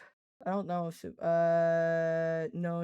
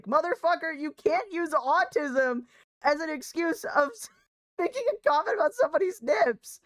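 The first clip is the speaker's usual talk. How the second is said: outraged rant; 7 semitones higher, 4 dB louder than usual. Real, not acted. Streamer ranting at a banned chatter's excuse, high and outraged. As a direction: call out the excuse in disbelief.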